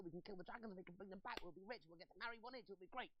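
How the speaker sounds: high-pitched breathless gush; excited blurting. Acted. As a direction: spill the whole secret in a high, eager rush. Naive brother blurting out everything.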